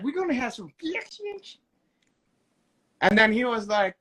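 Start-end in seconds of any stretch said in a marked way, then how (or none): 0.0-1.6 high-pitched mock impression; imitating his fixings line. Telling the co-host what Stevie's man said, high impersonation. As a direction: imitate him in a high put-on voice.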